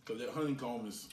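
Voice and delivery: talking with food in mouth; chewing while talking. Real, not acted. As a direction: mouth partly full of candy. Seen 0: Man in grey holds bar, bites and chews while talking.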